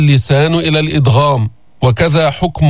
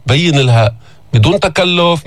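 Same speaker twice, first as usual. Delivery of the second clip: ordinary talk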